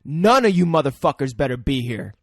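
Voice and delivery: loud, hammed-up tough-guy warning; mock tough guy. Chucky warning everyone before leaving, loud mock tough guy. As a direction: lay down the law loudly, in mockery. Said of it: "showed them who the tough guy was".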